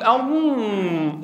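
"أم" drawn out through the nose, the wrong way; wrong nasal sound. Shown as a demonstration. Teacher showing students the nasal mistake, a drawn-out "أم". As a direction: hum "أم" long through the nose. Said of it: "لا تقول".

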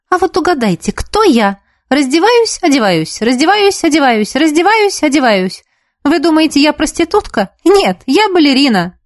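high, lilting sing-song alternation; playful riddle. A narrator's reading. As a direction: tease listeners with a riddle and its punchline. Girl performs a joke riddle. (игриво, нараспев, загадывая загадку)

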